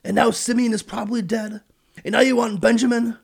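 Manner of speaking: high, loud melodramatic wail; super dramatic. Retold or imitated. Old father Jacob laments his sons, hammed-up high whine. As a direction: ham up the self-pity of an old father. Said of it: "He got super dramatic and said".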